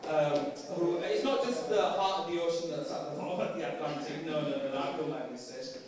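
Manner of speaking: lightly voiced brash Bradford teenager, laughing at her own jokes; a hint of a sassy nineteen-year-old from Bradford; Bradford teen persona. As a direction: play the cheeky Bradford girl. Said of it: "the actual way that I talk is like this".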